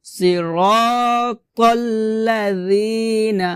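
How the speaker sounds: slow "সিরাতাল্লাযিনা" with th as in "this"; long-held vowels in "সিরাতাল্লাযিনা", tongue-tip dh; soft dhal sound. Shown as a demonstration. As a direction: recite "সিরাতাল্লাযিনা" slowly, dh not z. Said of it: "হবে সিরাতাল্লাযিনা".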